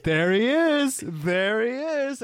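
high, slow, beaming There he is; overjoyed dad spotting his son; excited dad. Retold or imitated. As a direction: gush over the son like a proud father. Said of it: "He's like".

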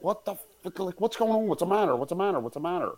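high urgent repeated questions; retold alarmed yelling. Jumping out of bed at the mother's cry, high alarmed questions. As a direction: call out in sudden alarm.

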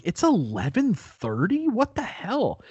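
a startled, high what-the-hell; shocked disbelief. Retold or imitated. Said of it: "and I'm like".

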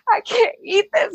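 high-pitched mock crying; retold tearful wail. Daughter upset over terrible gumbo, retold crying. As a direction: whine it high, imitating yourself in tears. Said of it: "I'm just crying".

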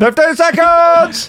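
high, slow coach shout; gym instructor impression. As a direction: bellow it like a trainer. Instructor call to Hayley.

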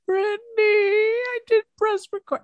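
high, crying mock wail. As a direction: wail it like a fake sob. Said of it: "I went".

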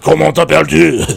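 hammed-up Quebecois French accent; French Canadian hockey guy. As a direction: say it like an old Montreal hockey guy. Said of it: "I changed my voice to sound like the old French Canadian hockey guys".

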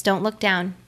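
normal talking voice

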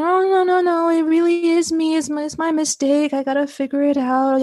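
replayed self-blaming spiral, starting high; insistent self-blame. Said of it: "kept on digging myself that little hole".

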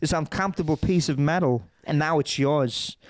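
halting film-monologue impersonation; Christopher Walken. Acted. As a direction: deliver it in chunks with long, odd pauses. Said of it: "Everyone watches Pulp Fiction and they're like".

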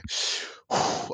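a big loud bracing exhale before a hard task; exasperated sigh. Retold or imitated. A nervous guy steeling himself at the shop door, loud sigh. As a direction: blow out air to steel yourself. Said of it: "he lets out an exasperated sigh".

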